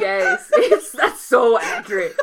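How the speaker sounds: loud, slow, laughing. Real, not acted. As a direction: laugh through it. Laughing agreement.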